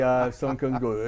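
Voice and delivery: ordinary delivery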